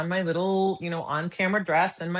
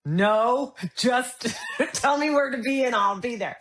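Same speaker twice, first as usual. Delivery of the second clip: eager laughing yes; 6 semitones higher than usual. Retold or imitated. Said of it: "And I went".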